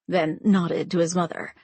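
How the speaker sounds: usual speaking voice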